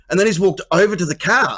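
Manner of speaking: ordinary delivery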